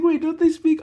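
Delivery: high, loud mock bafflement; confused schoolmate. Schoolmate asking about Canada's language, high baffled voice. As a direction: imitate a puzzled kid interrupting.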